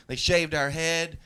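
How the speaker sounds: ordinary talk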